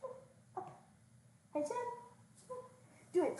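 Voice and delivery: high, breathy coaxing; calling a dog. Real, not acted.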